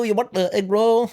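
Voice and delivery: hammed-up high-pitched Vietnamese accent; exaggerated Vietnamese-accent impression, very high. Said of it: "Vietnamese booth, they're like".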